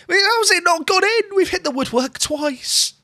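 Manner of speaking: high, slow, real cry of disbelief; emotional disbelief. Real, not acted. Manager watching his striker hit the post, high anguished disbelief. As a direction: pitch it way up, can't believe it hit the post. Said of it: "I'm getting very emotional here".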